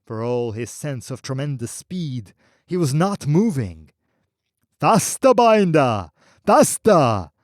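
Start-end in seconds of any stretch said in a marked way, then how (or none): 4.8-7.3 high, loud booming shout; a wild booming cry of faster. Acted. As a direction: whip him onward with the voice. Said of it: "cried the wild, booming voice".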